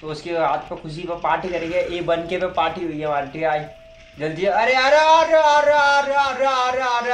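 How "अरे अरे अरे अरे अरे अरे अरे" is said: high, loud panicked cries; panicked yelling. Real, not acted. Gamer yelling in panic as enemy attacks. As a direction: yelp in alarm as he gets hit.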